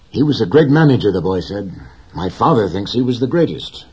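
put-on young boy, enthusiastic. A narrator's reading. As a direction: let the boy rush out his admiration. Boy's voice voiced by narrator.

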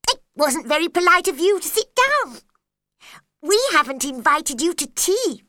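high, loud, odd March Hare voice; March Hare's voice. A narrator's reading. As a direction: throw Alice's words back, high and loud. Hare echoes Alice's words back.

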